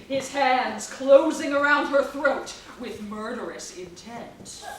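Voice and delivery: ordinary delivery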